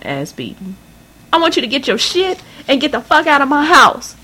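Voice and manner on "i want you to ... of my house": high-pitched mother throwing her out; a touch of a yell, voiced high. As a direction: order her out of the house, higher. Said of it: "Her loud yells".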